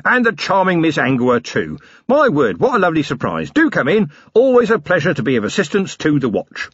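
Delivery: Harry King all matey to the captains; suddenly matey. Acted. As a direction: turn on the charm for the watch. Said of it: "his voice suddenly all matey".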